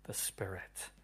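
whispered, drawing them in; hushed whisper. Acted. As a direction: breathe the words softly. Hushed close to sermon point.

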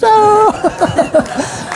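high laughing no of amazement; delighted disbelief. Retold or imitated. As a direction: gasp it out, laughing in wonder. Narrator reacts in delighted disbelief.

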